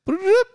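high rising electronic bloop; imitated phone chirp. Friend retelling restroom pranks, imitated high chirp. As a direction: mimic a sudden walkie-talkie alert. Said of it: "all of a sudden you hear".

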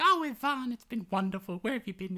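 mock an overjoyed girl throwing her arms around him; ecstatic princess. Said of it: "and she's like".

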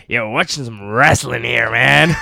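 put-on loud, drawn-out caricature of an American accent; mock American accent. Joking with a friend, loud mock American accent. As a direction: boom it like a cartoon American wrestling fan. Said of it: "with English, even if I do".

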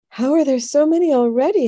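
put-on high, alarmed child's question; dismayed cry. A narrator's reading. Young brother seeing gnomes through screen door, dismayed cry. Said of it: "Harun cried".

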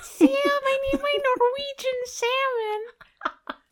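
high, slow, wailing through laughter; whiny character voice. Pretend. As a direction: whine it like a needy diva. Mock character quote, laughing.

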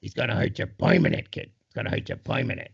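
put-on grouchy old trainer, voice dropping away; Mick. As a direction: growl it like an old boxing coach, fading to toneless. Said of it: "that Mick accent that you just did".

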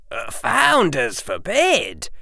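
high, slow mock-shocked boy; Harry voice. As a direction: feign horror at the idea.

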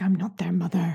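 whispered stern line; a hint of stern Jurati, whispered; mild stern whisper. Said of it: "she looks stern".

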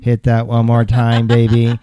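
hammed-up robot lover, drawn out; sexy robot voice. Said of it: "I'm sure the robot goes".

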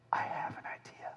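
conspiratorial whisper; hushed scheming. Retold or imitated. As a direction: lean in and whisper the plan. People scheming, voiced secretly.

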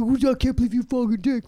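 high-pitched mocking whine of disgust; put-on voice of petty outrage; mock indignation. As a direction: imitate a scandalized, pouty complainer. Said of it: "they get mad, right?".

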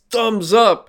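high, loud, hammed-up triumph; mock cheery cheer. Imitating survivors celebrating in the helicopter, mock cheer. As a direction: call it out like a corny movie ending.

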